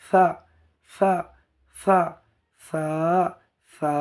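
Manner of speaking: careful lisped সা, like a speech impediment; lisped articulation. Shown as a demonstration. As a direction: lisp each সা with the tongue tip at the teeth. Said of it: "একদম তোতলা বেনে যাবে".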